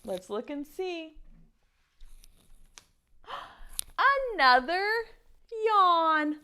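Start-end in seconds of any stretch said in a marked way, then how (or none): 3.3-4.3 sharp high gasp of surprise; surprised gasp. Acted. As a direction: gasp as if amazed. Turning card over.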